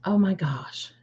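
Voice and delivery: awed breathless whisper. Real, not acted. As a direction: hush it out in awe. Awed reaction, said unvoiced.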